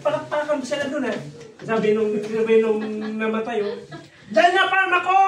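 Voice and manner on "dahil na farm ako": high, loud proud yell; loud triumphant shout. Retold or imitated. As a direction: shout it out to the whole wake.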